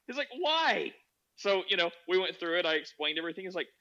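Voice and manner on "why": high-pitched incredulous cry; acted bafflement. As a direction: mimic a dumbfounded friend. Acting friend's bafflement.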